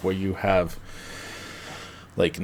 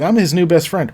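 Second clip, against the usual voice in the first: higher, eager dog voice; lightly acted cheerful introduction; Pietro the dog; 7 semitones higher, 4 dB louder than usual. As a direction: chirp it like an over-friendly dog. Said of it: "And Pietro's like".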